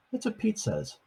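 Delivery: plain reading voice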